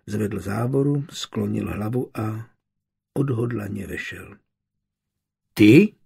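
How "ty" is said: surprised. A narrator's reading. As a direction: caught off guard, a short questioning blurt. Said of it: "řekla překvapeně Anna".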